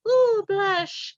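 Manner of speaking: bashful squeal. Retold or imitated. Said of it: "Varda's like".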